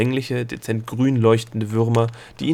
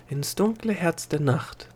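higher, hushed wondering repeat; wondering echo; 6 semitones higher, 4 dB quieter than usual. A narrator's reading. Delia echoing the gnome's destination, high and wondering. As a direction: echo it high and softly, savouring the words.

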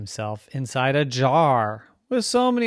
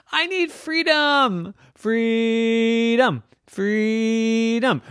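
loud, high, long-drawn cry; 11 semitones higher, 6 dB louder, much slower than usual. Acted. Rocky demands to leave the jar, crying out. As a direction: stretch the word like a rallying cry.

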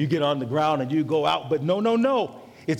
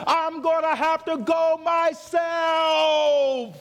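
preacher shouting the story toward Jesus; full-force preaching; 7 semitones higher than usual. Acted. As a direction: ride the climax like a gospel preacher.

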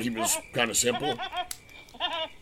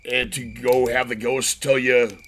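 ordinary talk, nothing marked

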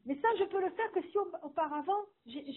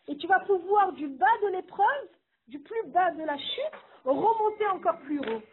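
fervent preaching; 3 semitones higher, 5 dB louder than usual. Acted. Preaching climax at full force.

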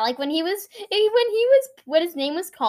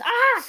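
retold scream; 11 semitones higher, 10 dB louder than usual. Retelling the movie's ending.

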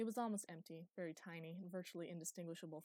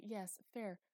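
ordinary delivery

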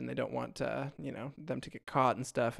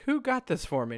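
indignant question; 10 semitones higher than usual. Retold or imitated. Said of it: "he's like".

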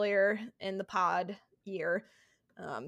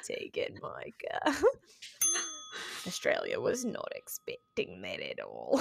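put-on Australian accent; 11 semitones lower, 5 dB quieter than usual. Host reading her co-host's scripted line in a put-on Australian accent. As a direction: draw it out in a hushed Australian accent. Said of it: "say it in an Australian accent".

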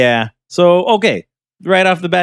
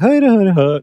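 mock Irish accent; 5 semitones higher than usual. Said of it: "It goes like".

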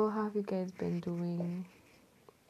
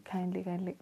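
ordinary talk

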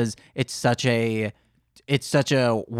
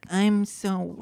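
higher, put-on babyish voice; baby voice attempt; 4 semitones higher, 4 dB quieter than usual. Shown as a demonstration. Host retries reading the note, in baby voice. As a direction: pitch it up like baby talk. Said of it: "How do you do a baby voice then?".

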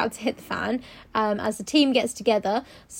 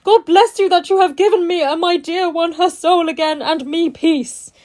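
high, loud hammed-up Arthur; gushing overwrought gratitude; 6 semitones higher, 7 dB louder than usual. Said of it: "he's like".

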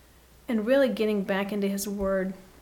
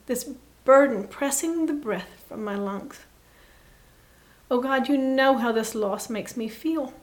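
lament prayer; 3 semitones higher, 5 dB louder than usual. Acted. Grieving sister praying her lament to God, raised and anguished. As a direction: pour out grief like a psalm of lament.

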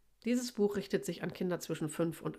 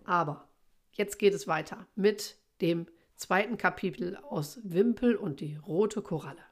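usual speaking voice

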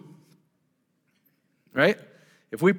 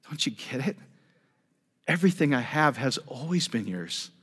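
softer, tender father to his son; a quiet plea from a loving father; hushed, tender fatherly reply; gentle father; 6 dB quieter than usual. Acted. Father answers older brother in parable.

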